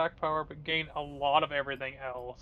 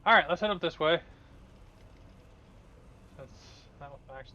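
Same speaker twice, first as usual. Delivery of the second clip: ordinary talk, nothing marked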